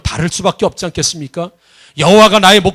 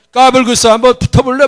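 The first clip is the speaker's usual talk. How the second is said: cocky taunt; 6 semitones higher than usual. Retold or imitated. Son taunting older boy.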